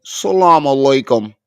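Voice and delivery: put-on slow, loud, flat "Salaam alaikum", no accent; mocking his way. Mocking the clip's man, slow loud flat greeting. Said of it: "even American Muslims say it with an accent".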